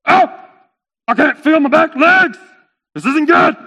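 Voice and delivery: high, loud comic alarm; alarmed cry. Acted. Camel waking with numb back legs, alarmed. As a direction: wail it out in mock panic.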